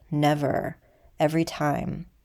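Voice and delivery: usual speaking voice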